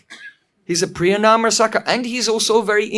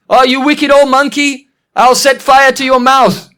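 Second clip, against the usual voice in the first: loud, high-pitched scolding, acted; angry threat; 5 semitones higher, 7 dB louder than usual. Padma runs after the mocking old monkey, angry threat. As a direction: hurl a storybook threat at a mischief-maker.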